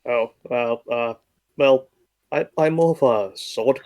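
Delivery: ordinary talk, nothing marked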